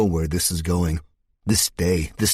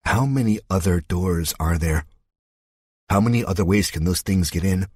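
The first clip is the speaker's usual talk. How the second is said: plain reading voice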